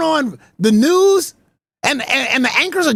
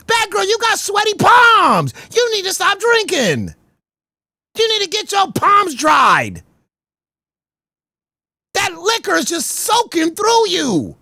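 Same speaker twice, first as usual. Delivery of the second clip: scolding shout; 6 semitones higher than usual. Real, not acted. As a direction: yell at her to sort herself out. Scolding Batgirl on stream.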